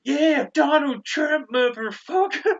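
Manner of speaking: high, loud, drawn-out wrestling cheer; over-the-top Trump fan. Retold or imitated. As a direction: bellow it like a hammed-up American heel. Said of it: "he was so over the top with it".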